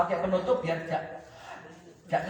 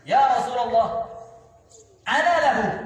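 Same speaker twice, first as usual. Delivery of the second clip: eager volunteering; 3 semitones higher, 6 dB louder than usual. Acted. Ali volunteering to the Prophet, loud and eager. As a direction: call out boldly to be chosen.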